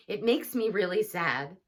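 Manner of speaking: ordinary delivery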